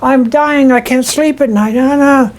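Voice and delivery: husband begging his wife not to make him let go; desperate protest. Retold or imitated. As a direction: protest like he cannot bear to lose her.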